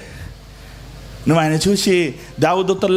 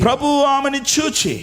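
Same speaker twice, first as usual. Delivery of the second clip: Prabhu stressed, slow and high; emphatic repeat; 9 semitones higher, slower than usual. Shown as a demonstration. Pastor echoing the verse for the church, high and slow. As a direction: say ప్రభు ఆమెను చూచి higher and drawn out.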